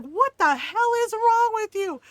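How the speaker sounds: high, loud mock mom shout; imitating angry mom. Kid's mom bursting in, imitated scolding shout. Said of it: "you could hear his mom in the background going".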